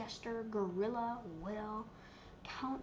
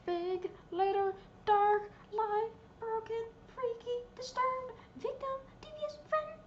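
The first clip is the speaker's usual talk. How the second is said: each word higher, rising to a squeak; climbing pitch; 10 semitones higher, 3 dB louder than usual. Pretend. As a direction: let the list climb up into falsetto. Reading title list, rising.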